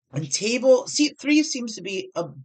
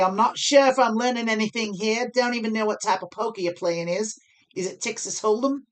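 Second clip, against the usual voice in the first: lightly done Australian accent, higher; 4 semitones higher, 5 dB louder than usual. Shown as a demonstration. Streamer reading a viewer's super chat, mock Australian accent. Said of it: "do it with my Australian accent".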